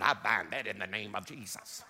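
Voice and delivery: mock spiritual warfare. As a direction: imitate someone rebuking under their breath. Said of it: "I hear somebody right now in the spirit".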